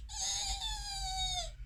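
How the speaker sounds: extremely high long squeal; high-pitched fairy screech; fairy shriek. A narrator's reading. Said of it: "and her sister shrieked".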